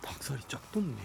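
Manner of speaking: muttered whisper; cursing under breath. Real, not acted. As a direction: whisper it to yourself, spooked. Spooked, whispers reaction.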